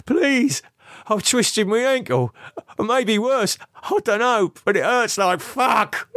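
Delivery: high, desperate begging voice; pleading. A narrator's reading. Lee injured and begging Camilla, high desperate plea. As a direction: beg for help through the pain.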